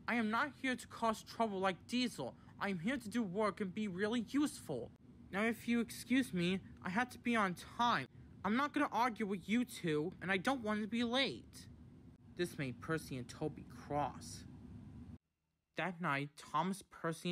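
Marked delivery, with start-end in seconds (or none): 0.1-11.7 high, insistent protest; indignant self-defence. A narrator's reading. Boko answers Percy and Toby's accusations, indignantly. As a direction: stand your ground in a raised voice.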